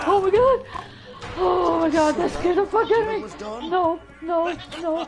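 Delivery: loud, high panicked yell; startled scream. Real, not acted. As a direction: shriek after a jump scare. Jump scare in horror game.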